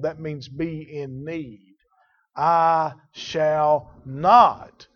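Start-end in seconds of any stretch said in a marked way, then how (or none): 2.4-4.9 slow loud stress on "I shall not", each word weighed; a firm declaration; definite statement. Shown as a demonstration. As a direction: plant "not" firmly, drawn out. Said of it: "That's a definite statement".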